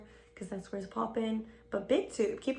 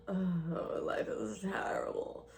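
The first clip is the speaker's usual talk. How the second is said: soft, slow fake lament; hammed-up quiet self-pity; mock misery; 2 semitones lower, 8 dB quieter, much slower than usual. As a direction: sigh it out in pretend despair. Playing the mock sufferer, sighing.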